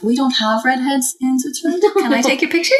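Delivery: chirpy mock Swiss accent asking for a photo; Swiss tourist voice. Swiss guy asking the redhead for a picture, mock accent. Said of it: "and was like".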